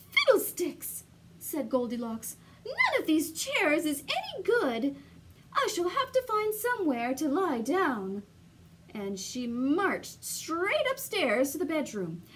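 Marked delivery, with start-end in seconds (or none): 0.1-1.0 high, loud cross exclamation; exasperated cry. Acted. Goldilocks on the floor after the chair breaks, exasperated. 2.6-8.2 higher, haughty complaint; huffy. Acted. Goldilocks grumbling about the broken chairs, huffy.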